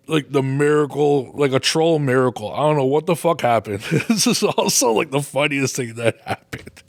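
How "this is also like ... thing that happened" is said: talking through laughter; giggling while telling it; laughing. Real, not acted. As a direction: laugh breaking into the words. Laughing at funny memory.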